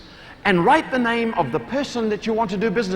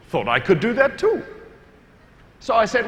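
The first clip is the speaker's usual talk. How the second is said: normal talking voice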